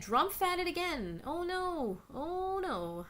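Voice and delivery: a hint of mock alarm reading chat. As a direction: play fake panic. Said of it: "As James said".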